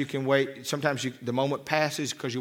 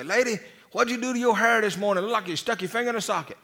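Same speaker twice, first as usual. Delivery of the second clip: put-on a light take on a cheeky good old boy; country boy greeter; 7 semitones higher than usual. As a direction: mimic the rude greeter teasing a lady. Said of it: "trying to be a good old country boy".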